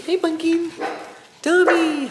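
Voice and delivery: very high dog-greeting voice; excited pet greeting. Real, not acted. Owner greeting dogs at home, high excited voice. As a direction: squeal hello to a beloved dog.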